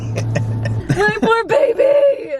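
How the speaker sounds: high, drawn-out wail half crying half laughing; real tearful laugh-cry over the puppy; laughing through tears. Real, not acted. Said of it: "You're crying".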